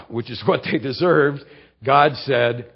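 normal talking voice

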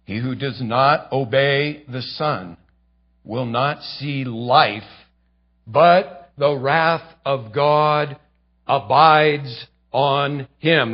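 slow grave scripture reading; slow solemn reading. Shown as a demonstration. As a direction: let "the wrath of God abides on him" sink in. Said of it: "keep reading".

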